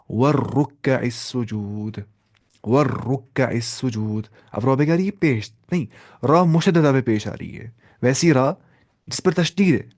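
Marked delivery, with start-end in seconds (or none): 0.1-4.3 वर्रुक्कइस with a long held heavy r, slow; heavy doubled r. Shown as a demonstration. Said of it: "वैसे ही". (वर्रुक्कइस में भारी रा, धीमे)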